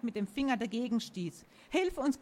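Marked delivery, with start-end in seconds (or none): 1.7-2.2 higher-pitched, urgent plea; gasped plea. A narrator's reading. Sophie begs the creature for help, gasping. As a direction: plead as if short of breath. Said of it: "keuchte Sophie".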